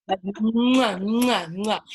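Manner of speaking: loud smacking kiss sounds; between kisses. Real, not acted. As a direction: kiss the puppy noisily. Kissing her puppy.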